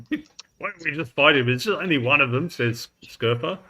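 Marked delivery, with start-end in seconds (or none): 0.1-2.5 higher, louder grumbling soldier; lightly hammed-up complaint; complaining. Game master as a man-at-arms wanting to fight, complaining. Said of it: "and the men-at-arms complaining".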